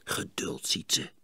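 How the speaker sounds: soft whispered calm. A narrator's reading. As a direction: breathe patience to your brother. Hushing brother while watching.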